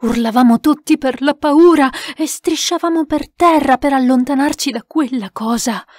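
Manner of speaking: usual speaking voice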